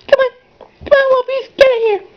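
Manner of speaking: high cartoon squeak to coax the pups; squeaky high call. Squeaky voice calling dogs.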